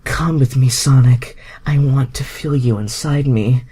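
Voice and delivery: high, loud, breathy plea; moaning Rogue. A narrator's reading. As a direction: beg him in a high, loud moan. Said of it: "Rogue moaned".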